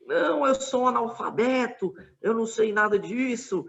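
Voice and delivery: imitando o grito do outro, mais agudo; grito de deboche, voz mais aguda; imitated shout. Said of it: "ele começou a gritar". (imitando o homem exaltado, grito agudo e zombeteiro)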